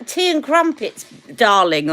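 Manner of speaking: high plummy "Tea and crumpets, darling"; posh mock British. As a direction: lightly play a toff. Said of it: "the way he said it".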